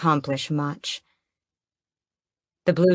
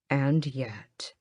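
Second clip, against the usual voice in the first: lower, soft, menacing And yet; dangerous low tone; 3 semitones lower, 7 dB quieter than usual. Acted. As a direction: drop the voice into a threat. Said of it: "I lower my tone and make it dangerous".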